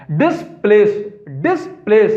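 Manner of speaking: Displace split into two stressed beats, dis and place; displace spoken as two separate, emphatic pieces; word split in parts. Shown as a demonstration. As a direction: break displace after डिस, then punch place. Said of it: "अपने place से जिसको डिस कर दिया जाए".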